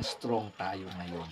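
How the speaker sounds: low, soft, holding back tears; subdued and halting, steadying yourself after welling up; nearly crying. Real, not acted. Vlogger admitting he almost cried, low and subdued. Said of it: "muntik na namang umiyak".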